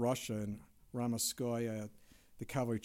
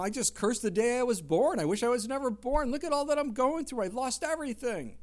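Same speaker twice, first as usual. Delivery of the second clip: anguished lament; 9 semitones higher, 6 dB louder than usual. Retold or imitated. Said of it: "he just said".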